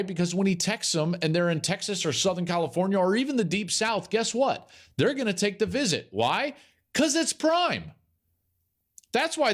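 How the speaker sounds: high-pitched, building hype; very excited. Real, not acted. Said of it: "I'm very excited about this".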